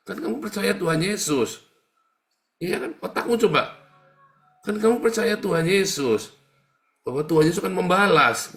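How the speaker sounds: high, loud sermon, scolding and pressing; fiery preaching. Real, not acted. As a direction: preach at full force to the flock. Preaching at full force. (khotbah berapi-api)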